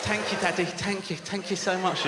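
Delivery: put-on high, softening child voice; grateful little child. Voices grateful child thanking Daddy.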